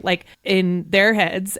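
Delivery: ordinary talk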